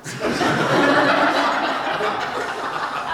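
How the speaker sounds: long soft airy laughter; breathy laugh. Real, not acted. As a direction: laugh quietly through the pause. Laughs at limping joke.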